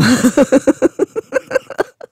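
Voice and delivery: high, long wheezy laugh; helpless laughter. Real, not acted. Speaker laughs at their own cold voice, helpless laughter.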